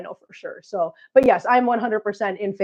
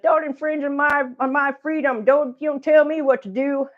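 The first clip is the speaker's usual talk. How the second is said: high-pitched mock Southern objector; Southern accent; 6 semitones higher than usual. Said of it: "I always give that a Southern accent".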